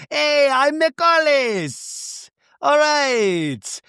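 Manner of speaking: high, drawn-out hearty hello; Michalis, excited greeting. Retold or imitated. Cousin greets the group, hammed-up excitement. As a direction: call out high, stretching each word.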